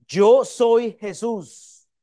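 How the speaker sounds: "Yo soy Jesús" proclaimed loud, high and slow; declaración enfática de "Yo soy". Shown as a demonstration. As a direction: stretch out each word of "Yo soy Jesús". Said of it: "Fonética, líricamente dice". ("Yo soy Jesús", alto y pausado)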